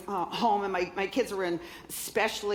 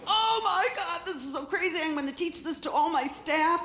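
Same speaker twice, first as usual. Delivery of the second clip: Oprah flipping out; 9 semitones higher than usual. Retold or imitated. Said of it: "She's like".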